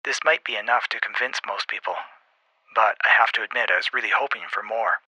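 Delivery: soft, mildly let-down radio voice; somewhat disappointed. A narrator's reading. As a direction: speak softly over the radio, a touch disappointed. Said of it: "Somewhat disappointed".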